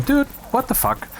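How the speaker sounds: squeaky high mock disbelief; high-pitched retold "dude, what the fuck"; mock exasperated outburst. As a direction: imitate a baffled friend snapping at you. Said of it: "and people are saying".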